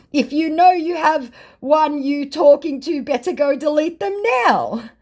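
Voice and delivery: hammed-up scammer read; mock scammer voice. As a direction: read his broken English like the scammer. Reading scammer message in voice.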